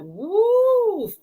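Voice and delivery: high, loud "woo"; sustained high hoot; drawn-out woo. Retold or imitated. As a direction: let out a long, high whoop. Relief recalled in a quoted thought.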